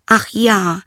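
put-on high, light girlish voice, a little dismissive; a young princess shrugging at the frog. Princess brushing off the frog, high girlish voice.

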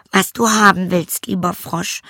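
put-on a disdainful young princess calling the frog. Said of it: "sagte sie".

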